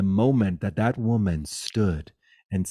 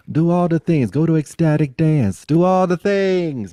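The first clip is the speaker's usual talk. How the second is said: loud, high, excited urging; rally the listeners to go do it all; rousing call; 7 semitones higher, 9 dB louder than usual. Real, not acted. Cheering the listeners on with a loud, high call.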